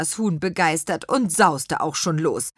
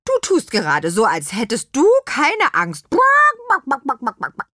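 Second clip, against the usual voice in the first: louder, huffy hen; indignant hen; 7 semitones higher, 6 dB louder than usual. A narrator's reading. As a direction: puff up and scold. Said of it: "plusterte sich empört auf".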